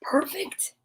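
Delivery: unvoiced, disbelieving whisper rising at the end; whispered question. A narrator's reading. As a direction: breathe it as a stunned question. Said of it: "thought Douglas".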